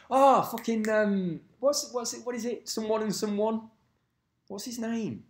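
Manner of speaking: high, lilting put-on Welsh voice; mock Welsh accent. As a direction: fumble for a name in mock Welsh. Carries on in attempted Welsh accent.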